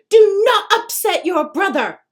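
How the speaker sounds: mother snapping at her son; yell. A narrator's reading. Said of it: "she yells".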